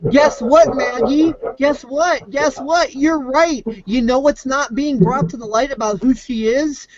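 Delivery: high singsong taunt. Pretend. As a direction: needle her in a high singsong. Taunting the commenter.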